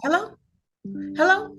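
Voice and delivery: high rising hellos down the line; high questioning hello. Retold or imitated. Caller checking if agent still there, high rising. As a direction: call out into silence, high.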